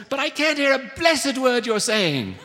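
high, hollered British apology; overbearing British lady on the phone; thick British accent. A narrator's reading. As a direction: call it out high in a British woman's voice. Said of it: "said the voice".

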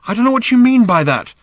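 high, loud, strained protest; panting and hurting. A narrator's reading. Father chasing his daughter on a bad leg, panting. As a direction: protest through pain and exertion. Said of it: "panting and hurting".

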